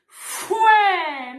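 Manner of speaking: loud contemptuous spitting noise; scornful spit sound. Real, not acted. Angry spitting exclamation at rival.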